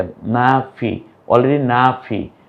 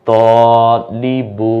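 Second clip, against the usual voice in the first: Shahida stretched syllable by syllable, each vowel held long; slow drawn-out reading; much slower than usual. Shown as a demonstration. Teacher to learners, showing the joined word, very slow. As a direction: draw out Shahida slowly and clearly. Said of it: "ও বলবে কি জানেন?".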